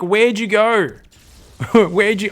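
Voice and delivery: imagined player's bewilderment. Acted. Commentator voices the player hunting for hidden units, mock bewilderment. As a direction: ask it as the baffled player. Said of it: "Cockschlumpf's like".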